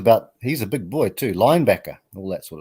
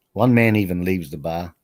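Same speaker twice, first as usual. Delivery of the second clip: usual speaking voice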